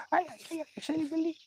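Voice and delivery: high, soft cooing to a pet; whisper-soft high coo at the dog. Real, not acted.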